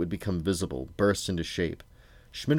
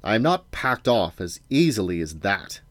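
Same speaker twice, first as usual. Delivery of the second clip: ordinary delivery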